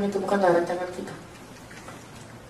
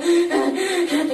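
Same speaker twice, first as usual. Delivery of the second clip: high, repeated gasping breaths; imitated laboured breathing; 8 semitones higher than usual. Preacher showing how he breathed during torture, imitated gasping. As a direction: imitate struggling for breath in bursts. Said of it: "saya bernapas itu".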